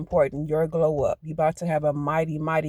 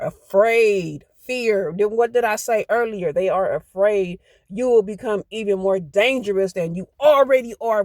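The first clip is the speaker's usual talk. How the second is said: louder and higher, real rising excitement; building fired-up energy, pushing the words out; getting hyped; 5 semitones higher, 7 dB louder than usual. Real, not acted. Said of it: "Ooh, I'm about to get hyped".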